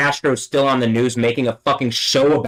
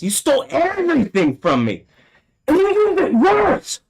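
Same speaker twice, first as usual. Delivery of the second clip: climbing much higher into a furious cry; rising rage. Real, not acted. Accusing the place that stole everything, furious and much higher. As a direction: let it break upward in anger. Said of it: "fuck, I'm angry".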